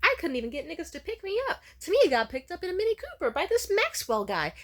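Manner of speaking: high, loud, amazed mom gossip; mom bragging. Retold or imitated. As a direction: gush like an envious proud mom. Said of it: "she would be like".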